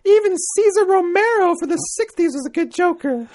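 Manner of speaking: high falsetto British lady reading aloud; hammed-up matronly falsetto; Mrs. Doubtfire. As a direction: read it out as an old lady, pitched sky-high. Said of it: "I like Doubtfire or Julia Childs".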